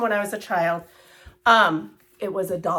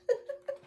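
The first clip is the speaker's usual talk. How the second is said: laugh; 8 dB quieter than usual. Real, not acted. Laughs off getting emotional.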